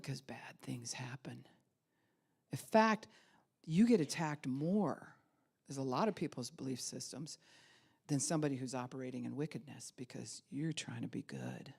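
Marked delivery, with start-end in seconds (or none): 0.0-1.5 whispered for weight; a preacher's whisper that draws the room in; emphatic whisper. Acted. 10.0-11.7 whispered for weight; a preacher's whisper that draws the room in; emphatic whisper. Acted.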